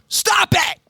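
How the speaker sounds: sudden high-pitched yell; explosive shout. Retold or imitated. Psychiatrist to his startled patient, sudden explosive shout. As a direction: blast it out as Bob the psychiatrist. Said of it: "Kind of blows the hair off her head".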